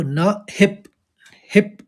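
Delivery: न हिप with the प pulled onto हि and stressed; stress joined to प. Shown as a demonstration. Teacher showing students how to read न हि प, joining हिप. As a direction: say हिप as one stressed beat. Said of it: "प का आघात लेंगे".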